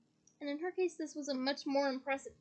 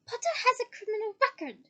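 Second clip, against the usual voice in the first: higher-pitched, raised-voice accusation; loud accusation; 6 semitones higher, 4 dB louder than usual. A narrator's reading. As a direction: announce it loudly, pitched up. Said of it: "Umbridge loudly".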